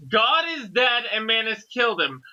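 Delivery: usual speaking voice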